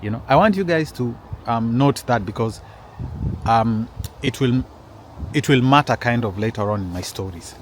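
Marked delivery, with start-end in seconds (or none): none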